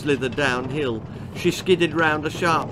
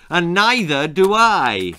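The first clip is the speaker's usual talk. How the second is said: louder angry roar; 3 semitones higher, 7 dB louder than usual. Acted. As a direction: bellow it out. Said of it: "roared the bogeyman".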